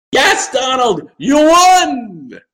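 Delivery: high, loud sarcastic shout; sarcastic shout at Donald; mock triumph shout. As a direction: yell it like a taunting cheer. Mocking Trump's hollow win.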